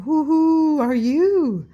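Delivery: high, loud, slow hooting owl. A narrator's reading.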